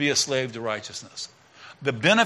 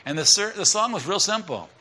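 usual speaking voice

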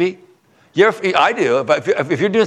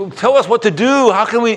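higher, louder frightened plea; pleading sailors; 9 semitones higher, 4 dB louder than usual. Retold or imitated. Terrified sailors begging Jonah what to do, high pleading. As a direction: beg for an answer in panic.

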